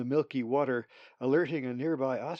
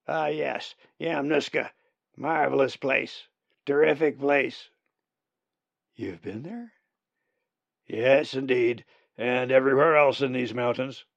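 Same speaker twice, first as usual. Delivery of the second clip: put-on old raven voice; 7 dB louder than usual. Old raven Uriah boasts.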